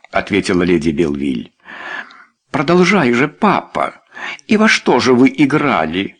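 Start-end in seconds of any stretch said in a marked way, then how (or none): 2.5-6.2 put-on high, girlish curiosity; eager interest. A narrator's reading. Daughter Lucinda prodding her father, curious. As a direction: urge Papa on, intrigued. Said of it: "заинтересовалась Люсинда".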